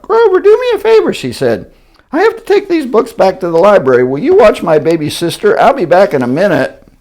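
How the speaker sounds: put-on very high, fast girl voice; squeaky rushed little girl; Betty Lou's voice. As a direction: rattle it off like a hurried girl. Betty Lou begging in a rush.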